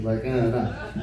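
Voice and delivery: usual speaking voice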